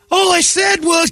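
high-pitched put-on protest; exaggerated high voice; mock protest. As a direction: squeal it in mock indignation. Mocking whiny quote of complainer.

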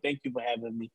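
ordinary talk, nothing marked

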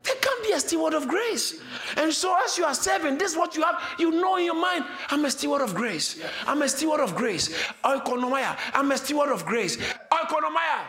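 high, ringing preacher's cry; fiery preaching. Acted. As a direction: preach it out with full fire. Preaching at full force.

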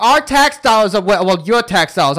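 a hint of loud Boston bar regular; Boston accent. Old local griping at visitors about taxes, imitated Boston accent. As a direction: lightly imitate a Bostonian grumbling. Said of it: "had the thickest Boston accent in the world".

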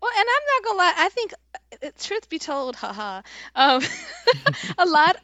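high giggly confession; nervous laugh. Real, not acted. Admitting a truth, laughing.

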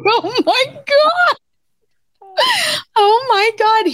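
high shocked cry. Retold or imitated. Wife reacting to husband seeing her insides, high shocked. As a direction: squeal it out in disbelief.

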